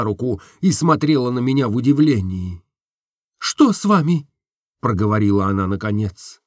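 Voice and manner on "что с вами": higher, concerned question; surprised young woman asking; Nastenka puzzled. Acted. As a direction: ask what is wrong.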